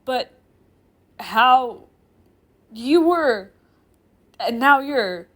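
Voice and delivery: loud, halting bewilderment; baffled. Acted. Professor seeing the girl in two places, baffled. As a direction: blurt fragments with pauses between.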